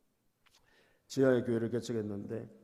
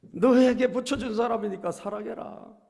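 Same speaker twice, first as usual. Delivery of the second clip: higher and louder, a firm divine command; God's command; 9 semitones higher, 10 dB louder than usual. Retold or imitated. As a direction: proclaim it as God speaking. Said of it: "사랑하라는 마음을 주시더란 거예요".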